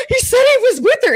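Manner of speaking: high, loud half-laughing sob; retold tearful wail. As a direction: replay your own teary blubbering, half laughing. Retells herself crying to her boss.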